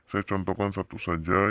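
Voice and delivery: ordinary talk, nothing marked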